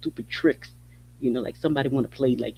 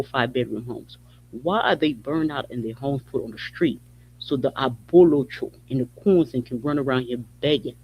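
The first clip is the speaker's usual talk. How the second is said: ordinary delivery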